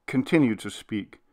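ordinary delivery

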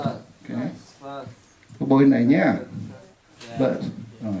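ordinary talk, nothing marked

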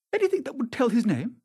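high, pressing question; startled questioning. A narrator's reading. Dorian seeking the dead man's name, startled. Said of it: "looking at the man with startled eyes".

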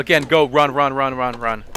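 loud frantic yelling to run; panicked shouting. Real, not acted. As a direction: yell at them to get out. Fleeing the ghost, yelling run.

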